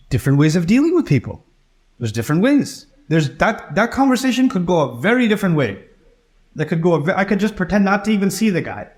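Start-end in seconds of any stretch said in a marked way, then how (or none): none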